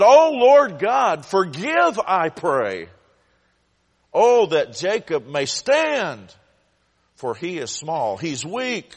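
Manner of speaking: loud pleading prayer, high and rising; crying out to God. A narrator's reading. Preacher voicing Amos pleading with the Lord for mercy. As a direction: beg the Lord for mercy, full voice. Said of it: "So Amos began to cry out to the Lord".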